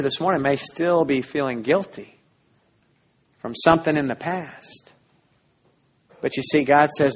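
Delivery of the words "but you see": ordinary delivery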